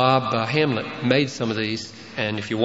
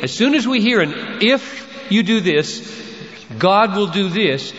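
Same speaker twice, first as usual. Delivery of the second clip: mild raised alarm; 7 semitones higher than usual. Acted. Preacher voicing the legalist reflex.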